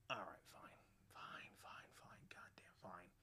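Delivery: resigned, sulky near-whisper; grumbled softly, dragging to a stop; grudging mutter. Retold or imitated. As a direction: give in under your breath, trailing down. Grudgingly giving in after refusal.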